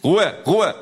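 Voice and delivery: sehr laut und hoch geschrien; brüll die Menge zur Ruhe; Schrei. Acted. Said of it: "ruft er". (gellender Ruf nach Ruhe)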